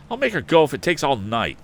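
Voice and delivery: loud, high and determined; energetic declaration. A narrator's reading. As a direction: vow it with fire. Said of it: "declared Tom energetically".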